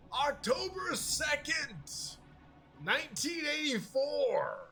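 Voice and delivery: high, slow, announcing the date like a dramatic opener; mock podcast host. Retelling the podcast intro to his co-host, high slow mock announcer. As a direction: ham up the host's big opening line. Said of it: "he's like".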